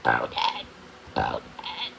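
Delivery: very low croaking frog voice; deep frog croak. A narrator's reading. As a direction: croak it out from the bottom of the chest like a big frog. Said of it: "Using his deepest frog voice, John Bob croaked".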